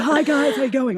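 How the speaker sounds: mock hoarse greeting after a big weekend; imitating her wrecked voice. Voice coach imitating herself after a big weekend, hoarse. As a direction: croak out a cheery hello. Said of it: "me doing an impression of me".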